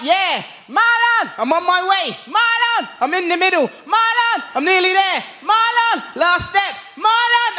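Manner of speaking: mock nagged son and calling mum; exasperated back-and-forth. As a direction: toss quick strained replies between mum's calls. Son answering mum's repeated calls.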